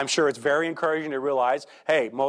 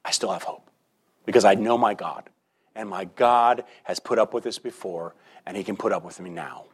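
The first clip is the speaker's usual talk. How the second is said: softer, lower and almost whispered at first; quiet hope; 6 semitones lower than usual. Retold or imitated. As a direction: confide your hope in God quietly. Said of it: "look back at passages like this and say".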